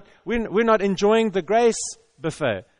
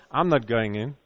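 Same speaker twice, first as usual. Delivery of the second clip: much lower sulky refusal, "not" rising; grumpy elder son; 8 semitones lower than usual. Acted. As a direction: pout it out like a grumpy kid. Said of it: "Mister Grumpy Pants".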